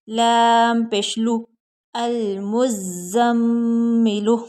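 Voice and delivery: slow, loud, drawn-out syllables; slow spelling drill. Shown as a demonstration. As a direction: spell each letter slowly with a nasal hold on the doubled मीम. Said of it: "spelling drill".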